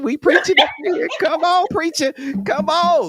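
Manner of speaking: very high, laughing calls of "come on"; egging on the preacher like a church crowd; hyped-up cheering. Real, not acted. Host hyping guest's preaching.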